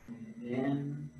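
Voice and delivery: soft, unhurried breath counting, each cue left to hang; guided breathing cues. Acted. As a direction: guide the class through each breath, quietly. Leads slow breathing cues.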